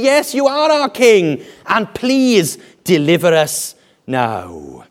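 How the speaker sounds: high, loud assent slowing into a plea; eager crowd. Acted. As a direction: acclaim loudly, then slow down to implore. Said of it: "And they say".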